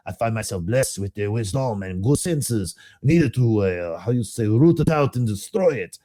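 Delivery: put-on SCP-049 lecturing Doctor Ham, faint French accent; doctor's accent. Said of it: "I'll try to do the accent".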